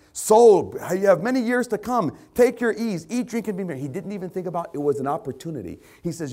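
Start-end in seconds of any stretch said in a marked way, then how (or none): 0.1-3.8 loud, high mock self-congratulation; hammed-up rejoicing, pitched way up; exultant rich man. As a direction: crow happily to your own soul. Rich man exulting to his soul.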